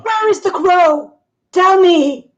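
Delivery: high, loud furious shout; furious demand. Acted. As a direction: demand it from the crowd. Demanding answers from villagers.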